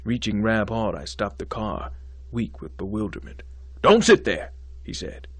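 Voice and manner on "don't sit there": loud, high, impatient command; sharp order. Acted. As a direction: snap him into moving. Dean orders student.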